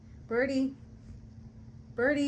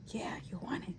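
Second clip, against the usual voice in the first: whisper; 3 semitones lower, 10 dB quieter, faster than usual. Real, not acted. Urging bird to jump.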